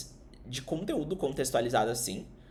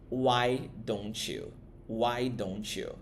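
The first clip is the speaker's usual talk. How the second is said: "Why don't you" drawn out slowly, then softer; slow model repeats; 3 semitones lower, 3 dB quieter, much slower than usual. Shown as a demonstration. Teacher drilling the phrase slowly and softly. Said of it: "toda vez que você quiser dizer".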